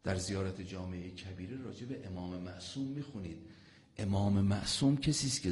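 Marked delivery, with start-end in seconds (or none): none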